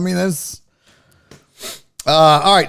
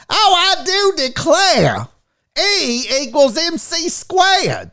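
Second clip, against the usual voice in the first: high, slow Southern belle drawl; mock Southern accent; 11 semitones higher, slower than usual. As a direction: stretch it out in a high Southern twang. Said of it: "I think Southerners sound stupid".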